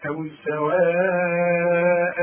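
سواء with the vowel held very long before the hamza; long madd. Shown as a demonstration. Quran teacher demonstrating the connected madd in سواء. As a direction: stretch سواء out long, slow. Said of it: "لو فتحتين تديني ألف كاملة".

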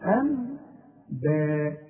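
أنباء with long hummed meem, very slow; iqlab ghunna. Shown as a demonstration. Teacher recites example for class, slow ghunna. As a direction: stretch the nasal hum in أنباء. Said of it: "شوف".

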